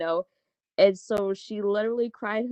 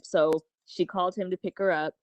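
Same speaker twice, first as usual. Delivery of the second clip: usual speaking voice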